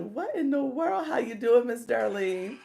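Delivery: greeting a regular viewer with a high happy shout; excited greeting. Retold or imitated. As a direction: call out to her with playful surprise. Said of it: "I was like".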